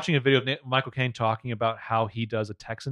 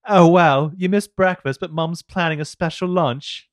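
put-on loud, high-pitched English accent; 6 semitones higher, 7 dB louder than usual. Evil twin greets her captive sister, mock English accent. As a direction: put on a cheery English voice, louder and higher. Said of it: "The English accent".

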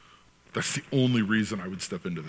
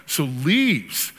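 模仿律师肯定; 5 semitones higher, much slower than usual. Retold or imitated. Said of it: "he's interviewing this guy".

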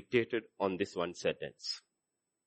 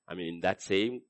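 ordinary talk

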